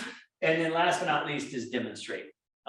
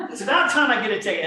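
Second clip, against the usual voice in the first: loud, high, laughing quote; laughing through it; 7 semitones higher, 9 dB louder, faster than usual. Real, not acted. Retelling his joke to the cop, laughing loudly. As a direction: get the line out while still laughing. Laughing while quoting himself.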